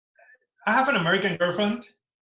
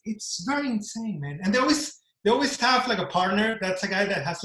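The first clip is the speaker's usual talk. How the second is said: ordinary talk, nothing marked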